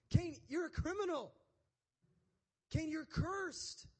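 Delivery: high, impassioned accusation, rising in volume; God. Acted. God confronts Cain over the murder.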